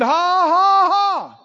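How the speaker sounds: loud put-on "ha, ha, ha"; mock forced laugh. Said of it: "He said".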